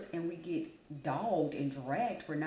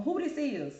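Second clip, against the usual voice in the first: higher, louder mock phone greeting; phone slang greeting; 8 semitones higher, 4 dB louder than usual. Mimicking folks who answer the phone like this. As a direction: imitate someone answering the phone casually. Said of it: "people that'll get on the telephone".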